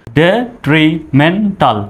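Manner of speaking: Detrimental said very slowly, syllable by syllable; slow broken-up demo. Shown as a demonstration. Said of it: "সহজভাবে উচ্চারণ করুন ভেঙে ভেঙে".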